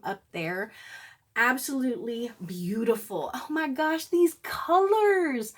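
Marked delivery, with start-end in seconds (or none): 3.3-5.5 high, thrilled gush over the colors; slow, delighted swoon; excited gush. Real, not acted. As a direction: gush like you can't believe how pretty they are. Unboxing makeup palettes, thrilled.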